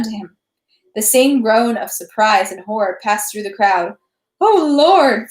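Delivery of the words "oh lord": high, loud lament; rueful cry. A narrator's reading. Onlooker crying out at the blow, rueful. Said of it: "someone cried ruefully".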